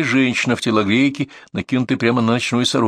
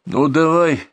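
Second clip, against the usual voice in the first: higher-pitched urging; calling out; 6 semitones higher, slower than usual. A narrator's reading. Narrator urges the modeller to go, calling out. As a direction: urge him from a distance. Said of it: "крикнул я модельеру".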